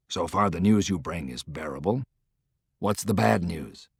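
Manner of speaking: a hint of a weary commander, held back; lightly voiced tired general, low and soft; lightly voiced Grant.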